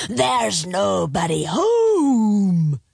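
loud, very slow monster roar; dragon's roar. A narrator's reading. Dragon answers the knock at his cave, roaring. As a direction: roar it out, stretching the last word. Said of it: "roared a voice".

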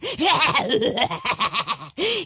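big booming dragon laugh; roaring laugh. A narrator's reading. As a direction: roar with helpless laughter. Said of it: "he began to laugh".

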